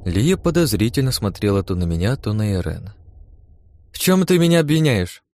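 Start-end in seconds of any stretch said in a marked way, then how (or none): 3.9-5.2 high-pitched, astonished question; raised pitch, taken aback; surprised. A narrator's reading. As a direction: ask in indignant surprise at being accused. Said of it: "удивлённо спросила вторая".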